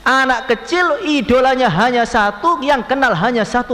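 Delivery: ardent sermon climax; fervent preaching. Acted. Preacher stresses child's devotion.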